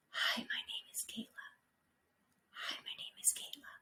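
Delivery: fully whispered introduction. Shown as a demonstration. Teacher whispering her name to show no vibration. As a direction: breathe it out with no voice. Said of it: "but whisper".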